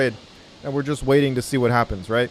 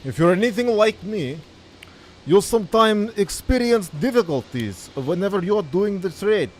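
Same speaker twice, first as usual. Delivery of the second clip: hammed-up Russian accent, pitched higher; mock Russian accent; 4 semitones higher than usual. YouTube trader opening his video to viewers in a put-on Russian accent. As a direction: lay on a thick joke Russian accent to tease nervous traders. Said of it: "I can't do this accent the whole call".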